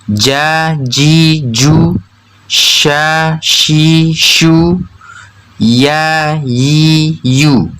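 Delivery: ja, sha, ya each chanted with long a, i, u; mid-tongue drill. Shown as a demonstration. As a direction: drill ja ji ju, sha shi shu, ya yi yu evenly and drawn out. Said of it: "Tengah lidah menempel pada langit-langit".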